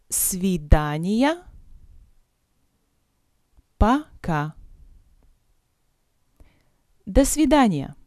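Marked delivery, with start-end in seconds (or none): none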